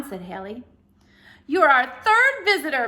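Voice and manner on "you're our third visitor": loud, higher game-show announcement; jolly booming announcer. A narrator's reading. Ceiling announcer telling Haley she's the third visitor, jolly boom. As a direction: boom it like a prize host. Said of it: "boomed the jolly announcer".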